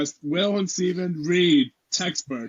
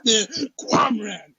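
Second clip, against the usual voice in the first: high-pitched, hammed-up textbook read-aloud; over-the-top eager classroom reader; super-enthused reading; 7 semitones higher than usual. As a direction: read dry history as if it thrills you.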